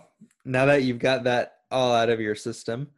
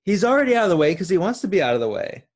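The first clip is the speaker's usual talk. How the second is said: heated protest; 8 semitones higher, 6 dB louder, faster than usual. Real, not acted. Defending the character heatedly.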